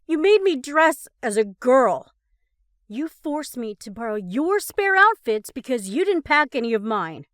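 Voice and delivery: loud, high angry accusations; furious ranting. A narrator's reading. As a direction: unload every grievance. Ross continuing furious accusations.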